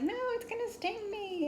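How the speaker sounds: high-pitched mock panic wail; imitated childish panic, very high; freaking out. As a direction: act out a scared kid refusing, voice way up. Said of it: "And I was freaking out".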